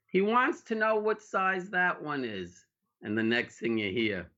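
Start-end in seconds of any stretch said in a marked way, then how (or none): 0.1-2.7 high and slow, carried across the store; relayed call. Retold or imitated. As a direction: clerk passes the question to the back room, called out slowly. Relaying the question to the staff.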